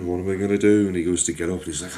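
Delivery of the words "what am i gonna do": louder, rising panic on the repeat; panicked cry. Acted. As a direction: cry it out in alarm. Jack hurt, panicking.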